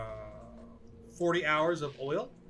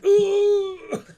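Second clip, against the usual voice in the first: loud, high sustained wail; mock cry of alarm, held high; high frightened "ooh"; 12 dB louder than usual. Prisoner shouted at by his interrogator, high loud wail. Prisoner reacts to being intimidated.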